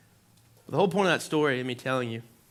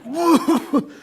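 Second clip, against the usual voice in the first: high, loud startled whoa; shocked whoa; 12 dB louder than usual. Retold or imitated. Pulled from the river, a startled high whoa. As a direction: gasp it out in shock. Shocked rescue, mock self.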